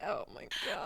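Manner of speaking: breathy whisper of embarrassment; whispered despair. Retold or imitated. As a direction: whisper it, mortified, facing away. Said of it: "He does the, like, curl up in a corner".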